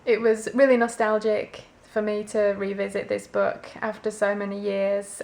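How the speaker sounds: ordinary talk, nothing marked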